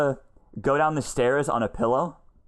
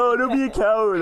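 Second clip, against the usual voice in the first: higher, mildly mocking jeer; retold taunt; 8 semitones higher, 4 dB louder than usual. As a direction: goad a friend, half in jest. Retelling own taunt to friend.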